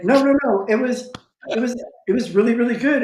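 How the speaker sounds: high, eager mock gushing tutor; gushing praise. Tutor gushing over his cast, mock excited high voice.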